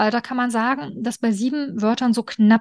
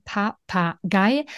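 Papagei split slowly into Pa-pa-gei; syllable clapping; much slower than usual. Shown as a demonstration. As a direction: say Papagei one beat per syllable. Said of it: "machst dann am Beispiel Papagei das einmal für das Kind vor".

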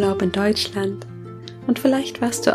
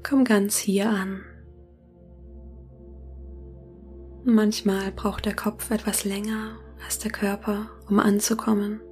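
soft spa whisper; 8 dB quieter than usual. Acted. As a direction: whisper gently, guiding them into calm. Leitet eine Meditation. (sanftes Meditationsflüstern)

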